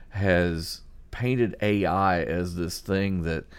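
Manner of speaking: ordinary talk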